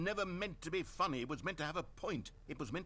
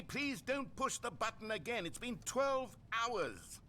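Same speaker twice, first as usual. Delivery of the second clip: pleading; 7 semitones higher, slower than usual. Acted. Narrator begging Stanley.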